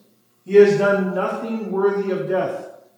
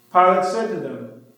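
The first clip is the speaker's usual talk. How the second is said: plain reading voice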